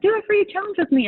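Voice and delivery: high, fast, peppy pitch voice; hammed-up upbeat promoter; mock sales pitch. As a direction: chirp it like an eager online coach selling a free class. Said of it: "they're like".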